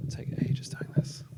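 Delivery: under the breath, unvoiced; whispered aside. Real, not acted. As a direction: mutter it to yourself while fiddling. Fiddling with the laptop, muttered aside.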